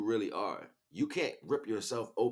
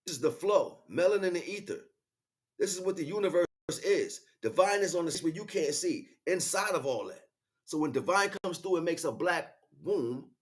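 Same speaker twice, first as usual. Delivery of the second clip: higher, louder, building sermon delivery; fired-up preaching; 7 semitones higher, 4 dB louder than usual. Acted. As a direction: preach it with rising fervor. Preaching rises in excitement.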